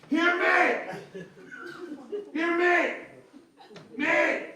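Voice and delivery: high, strained yell after a passer-by; angry shout down the street. Retold or imitated. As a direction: bellow it down the street like a toothless drunk. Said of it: "turns and shouts down the street at him".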